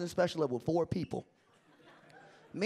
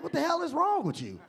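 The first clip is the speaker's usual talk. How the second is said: exasperated protest; 7 semitones higher than usual. Retold or imitated. Protests the sudden praise after booing.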